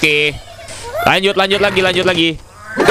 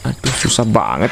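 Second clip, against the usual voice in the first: ordinary talk, nothing marked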